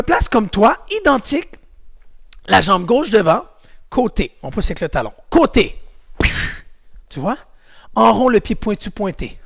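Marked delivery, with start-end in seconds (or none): 5.3-5.8 loud, high kick call; called command. Shown as a demonstration. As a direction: bark the move for the class. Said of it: "calling kick commands".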